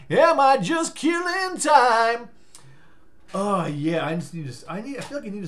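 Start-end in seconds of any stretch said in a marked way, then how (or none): 0.1-2.3 slow, high, loud stretched question; mock dramatic question. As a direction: draw it out theatrically. Drawn-out repeat.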